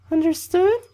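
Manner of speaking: loud, very high falsetto camp leader; squeaky mock female scolding; bad girl voice. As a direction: check on them in a badly faked woman's voice. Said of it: "That was a really bad girl voice".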